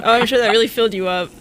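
mock her, quick and sarcastic. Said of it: "I'm like".

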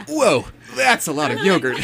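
high, laughing imitation of an anime hero; anime protagonist voice. Host teasingly imitating the reader, anime protagonist voice. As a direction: mimic a wide-eyed anime lead, amused. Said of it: "in an anime protagonist voice".

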